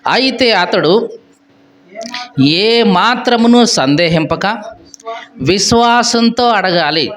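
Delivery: slow, measured reading of the verse; scripture reading. Acted. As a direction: read each phrase slowly and solemnly.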